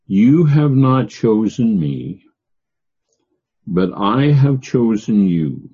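slow, with weight on the pronouns You, me, I and you; emphatic scripture reading. Shown as a demonstration. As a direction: lean on each pronoun as if pounding the table. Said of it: "They're emphatic".

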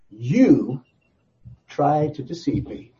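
"You" set apart before a slow "tried to deceive me"; stress on "You". Acted. God blaming Satan, pointed stress on "You". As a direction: isolate "You", then pause. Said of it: "puts emphasis on you".

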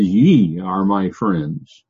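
Ye said slowly and pressed hard; emphatic pronoun. Shown as a demonstration. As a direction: drag out "Ye" as if pounding the table. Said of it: "They're emphatic".

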